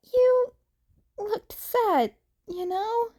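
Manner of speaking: high, mild mutter of Izuku. A narrator's reading. Izuku tells the man he looked sad, mildly muttered. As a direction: say it half to himself. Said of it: "he muttered".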